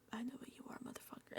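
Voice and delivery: soft whispered jab; a sly whispered insult to the screen. Real, not acted. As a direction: whisper it under your breath at him. Muttered insult at Riley.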